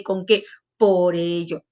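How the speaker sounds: ordinary talk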